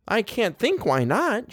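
high, slow haughty surprise; surprised. A narrator's reading. Wife puzzled that anyone objects, haughtily surprised. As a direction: sound taken aback, drawing it out high. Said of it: "Missus Montague sounded surprised".

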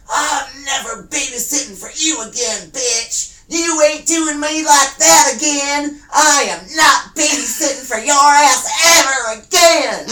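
high, loud furious yell. Retold or imitated. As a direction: scream it across the street. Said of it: "just yelling up".

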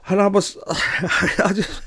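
laughing through the words, slowed down; can't get the words out for laughing. Real, not acted. Telling the listeners a mad story, laughing.

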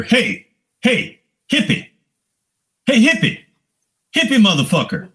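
high, taunting call across the cage. Retold or imitated. Stranger in a parka calling out to the hippie. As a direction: heckle him from a distance.

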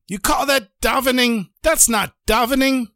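high-pitched, indignant rebuke; scolding shout. Acted. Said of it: "he shouts at him".

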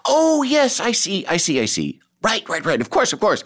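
lightly voiced polite agreement; a hint of breezy, posh-free yes-man; mock agreeable. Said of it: "And Terrence would go".

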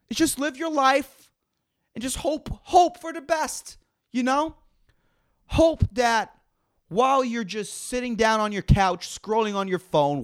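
normal talking voice

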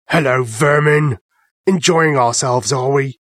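slow, sneering farmer voice; menacing farmer. Acted. Farmer with shotgun taunting the mice, slow and sneering. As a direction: taunt the vermin slowly.

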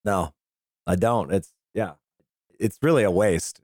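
normal talking voice

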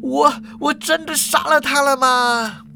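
high, halting, horrified disbelief; stunned and panicky, stretching into a wail. Acted. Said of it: "一股恐慌感打从心里冒了出来啊".

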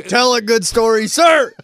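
high, loud, slow barked order; drill sergeant bark. Pretend.

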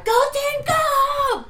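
high, full-on team cheer yell; rally the troops at full voice; rally cry. Acted. Blinded player rallying her team in battle, shouting. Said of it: "like, a full-on rally cry".

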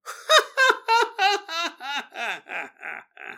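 ghostly high giggle; high eerie woman's laugh. Retold or imitated. A ghost woman laughing beside the dog, eerie high laugh. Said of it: "they hear a woman laugh".

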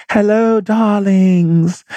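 high, sing-song greeting of a glamorous diva; aunt's greeting. Retold or imitated. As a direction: gush hello like a star greeting fans. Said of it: "she'd put a smile and come out and be like".

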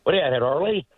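Newfoundland accent, dropping the H in Hurley. Shown as a demonstration. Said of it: "A lot of Newfoundlanders add an H".

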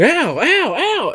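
very high, repeated mock yelps; mock screaming. Little boy with wasps up his shorts, mock screaming. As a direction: imitate a little kid getting stung. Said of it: "running and a screaming".

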